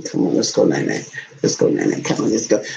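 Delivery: deep hushed bedtime coaxing; low whispered coo. Acted. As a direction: murmur low to put him to bed.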